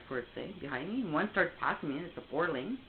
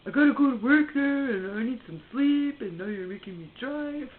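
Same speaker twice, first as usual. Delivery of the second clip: higher, louder whiny mock complaint; mimicking his complaint; 8 semitones higher, 8 dB louder than usual. Woman mocking her boyfriend's grumbling about having to drive, whiny mock complaint. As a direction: imitate the grumpy boyfriend's whining. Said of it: "He's all pissed off because".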